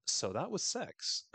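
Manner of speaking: deadpan disappointment, drawn out; unimpressed Jackie. Retold or imitated. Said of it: "She's all like".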